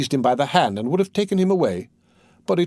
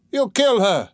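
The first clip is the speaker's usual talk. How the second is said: higher, louder shout of alarm; warning shout; 8 semitones higher, 3 dB louder than usual. A narrator's reading. Onlooker warning Mikolka off the mare, alarmed shout. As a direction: cry out that she'll die. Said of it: "shouted the third".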